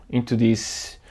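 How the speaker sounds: ordinary delivery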